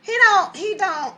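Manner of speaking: very high-pitched, incredulous disbelief; imitating a father baffled and upset, stammering. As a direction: play a flabbergasted dad, voice shooting up. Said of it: "his father was very upset".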